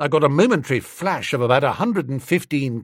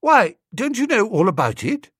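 mild surprise; 6 semitones higher, 3 dB louder than usual. A narrator's reading. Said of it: "gazed at me in mild surprise".

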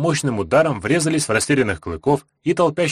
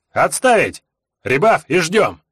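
loud, higher barked command; shouted order; 6 semitones higher, 4 dB louder, slower than usual. A narrator's reading. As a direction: bark the order across the field.

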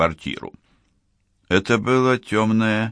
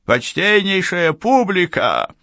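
put-on high, theatrical old-school showman; booming circus ringmaster; 9 semitones higher than usual. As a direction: announce it grandly to the audience. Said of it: "особую театральную интонацию загримированного конферансье".

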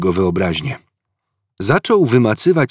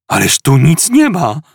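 louder, higher disbelieving outburst; shocked outcry; 6 semitones higher, 5 dB louder than usual. Acted. Arthur finds nothing about Earth, shocked outcry. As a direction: cry out in disbelief at the page. Arthur sees empty entry.

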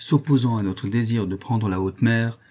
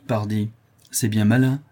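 ordinary delivery

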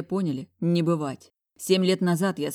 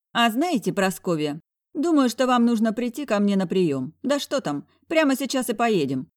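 smug doctor; 6 semitones higher, 4 dB louder than usual. A narrator's reading. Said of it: "хмыкнул Барбарисыч".

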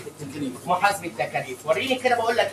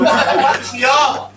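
laughing mock reply; 6 semitones higher, 7 dB louder than usual. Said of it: "اقول له".

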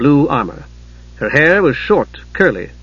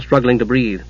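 ordinary delivery, nothing marked

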